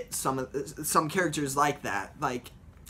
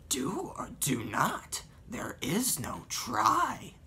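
slow whispered Yoda voice; a raspy little sage, slow and airless; 5 semitones higher, 4 dB quieter, much slower than usual. Retold or imitated. Yoda giving wise advice in a slow whisper. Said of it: "Which is Yoda".

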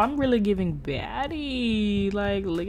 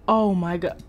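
ordinary delivery

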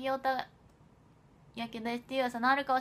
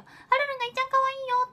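mock Korean accent on 「はるるんがいっちゃん可愛いよ」, much higher, louder and fast; 13 semitones higher, 6 dB louder, much faster than usual. Shown as a demonstration. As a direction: rattle it off high in a playful fake Korean accent. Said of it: "韓国カタカナで書かなきゃ".

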